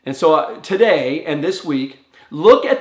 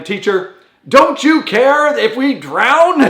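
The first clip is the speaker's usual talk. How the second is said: high, loud and slow, breaking into a laugh; hammed-up pleading over drowning; mock desperate plea; 9 semitones higher, 6 dB louder, slower than usual. Disciples waking the sleeping Jesus, pleading in hammed-up panic.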